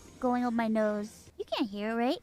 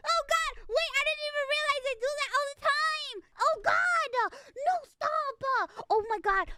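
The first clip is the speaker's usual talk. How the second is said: high squeaky flustered squeals; embarrassed high-pitched protest; cute anime streamer; 10 semitones higher than usual. Real, not acted. Streamer reacting to clip.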